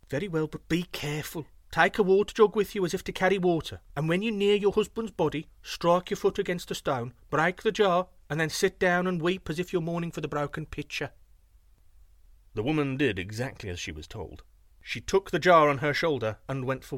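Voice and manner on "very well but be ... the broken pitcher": higher, louder thief giving careful instructions; lightly voiced thief laying out the plan; instructing thief. A narrator's reading. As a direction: thief coaches the widow on her trick.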